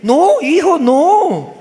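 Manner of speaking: loud, very high frustrated father; worked-up correction, "rico" as "lico"; exasperated dad. Acted. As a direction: scold the son into saying it. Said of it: "papá no puede pronunciar rico".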